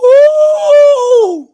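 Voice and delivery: long high mock ooh; a sustained high ooh like a studio crowd; imitating the crowd. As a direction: copy the audience's teasing ooh. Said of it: "See, they went".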